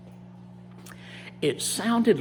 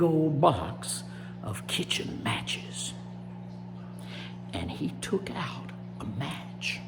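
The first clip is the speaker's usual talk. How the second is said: hushed storytelling; 4 dB quieter than usual. A narrator's reading. Storyteller revealing what Rabbit did with matches, hushed and suspenseful. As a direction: draw them in quietly, piece by piece.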